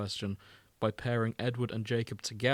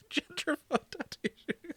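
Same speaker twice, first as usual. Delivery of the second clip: laughing echo. Real, not acted. Echoing the joke, laughing.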